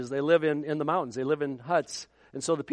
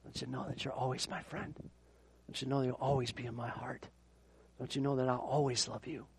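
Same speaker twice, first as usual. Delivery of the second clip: tender farewell; 5 semitones lower, 11 dB quieter than usual. Retold or imitated.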